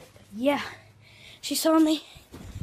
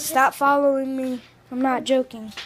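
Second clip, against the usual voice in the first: annoyed; 11 dB louder than usual. Real, not acted. Sibling annoyance.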